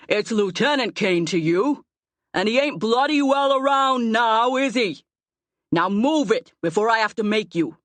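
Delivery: rough-spoken, menacing command; gruff bully. A narrator's reading. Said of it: "the gruff voice says".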